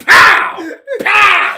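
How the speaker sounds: high, loud shouted pow sounds; punch noises. Retold or imitated. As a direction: shout the punches like a cartoon fight. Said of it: "he's just like".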